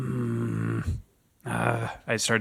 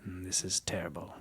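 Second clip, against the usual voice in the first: whisper; 3 semitones lower, 11 dB quieter than usual. Acted. Player whispers in character.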